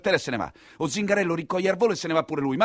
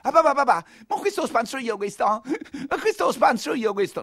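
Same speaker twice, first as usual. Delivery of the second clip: put-on high, keen voice of a young thug, laughing at the end; a boy begging his father to let him stab; high giggling kid; eager boy; 10 semitones higher than usual. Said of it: "Interviene pure il ragazzetto".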